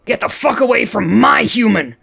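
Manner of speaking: loud snarling threat; angry growl. Acted. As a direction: growl it at him, protective. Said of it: "Bob growled up at Ace".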